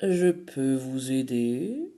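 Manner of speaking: low, slow fox voice; sly fox. Acted. The fox offers the girls help, low and slow. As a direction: purr the offer slyly.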